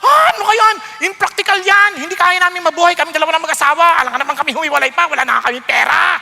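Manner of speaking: loud, high outburst rushing into complaints; mock angry objection. Preacher acts a spouse rejecting leave and cleave, loud and outraged. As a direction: protest indignantly, speeding up as you go. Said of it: "Nagagalit agad".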